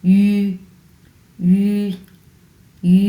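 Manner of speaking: ই pushed through tensed lips, like French u; slightly hard vowel. Shown as a demonstration. Teacher demonstrating a harder vowel to learners, pressed sound. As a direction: press the lip sides and hold ই firmly. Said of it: "একটু হার্ডভাবে".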